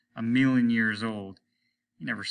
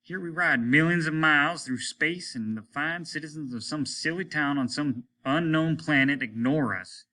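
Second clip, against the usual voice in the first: higher, louder indignant captain; sarcastic ranting captain; snorting captain; 6 semitones higher, 4 dB louder than usual. Acted. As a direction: scoff at the town's neglect. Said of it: "he snorted".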